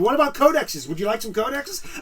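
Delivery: high, eager salesman pestering; hammed-up clerk pushing paint; pushy shop assistant. Mocking pushy shop staff.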